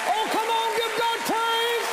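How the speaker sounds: fiery preacher shouting God's praise; preaching at full force. Real, not acted. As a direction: lift the church into hallelujah.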